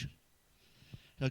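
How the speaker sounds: normal talking voice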